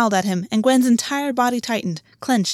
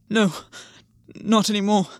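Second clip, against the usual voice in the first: gasped. A narrator's reading. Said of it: "Gwen gasped".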